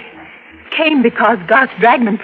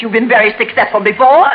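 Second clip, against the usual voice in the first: usual speaking voice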